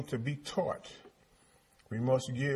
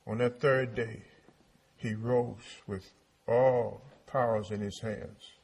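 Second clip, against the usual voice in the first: ordinary talk, nothing marked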